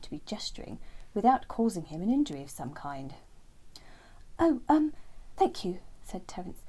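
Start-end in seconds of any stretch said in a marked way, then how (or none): 4.4-5.8 a touch higher, hesitant; shy little bird. Acted. Terence declining Lenny's foot, lightly voiced shy bird. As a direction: politely hesitate as a small bird.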